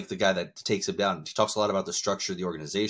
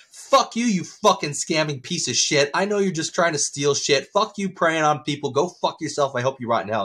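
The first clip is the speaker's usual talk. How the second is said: raised, higher-pitched cursing outburst; angry tirade; 6 semitones higher, 4 dB louder than usual. Acted. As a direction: tear into a scammer, acted rage easing at the end. Acted tirade at phone scammer.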